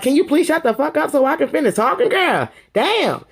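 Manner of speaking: Sheree's lightly voiced fed-up outburst; fed-up snap. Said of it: "Sheree bossed up on her".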